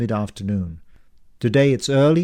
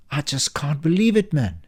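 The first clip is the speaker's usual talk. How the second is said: disbelief; 5 semitones higher than usual. Acted. Clerk shaking his head.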